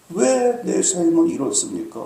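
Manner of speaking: normal talking voice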